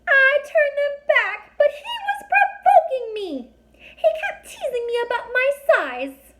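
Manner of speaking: very high, loud, tearful fairy voice; tiny fairy crying as she explains. A narrator's reading. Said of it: "and cried some more".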